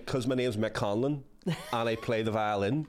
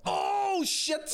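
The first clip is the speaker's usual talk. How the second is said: sudden high yelp of shock; surprised shout. Real, not acted. Storyteller interrupted by a near miss, surprised shout. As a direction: jolt out of the story with a cry. Shot hits the bar mid-story.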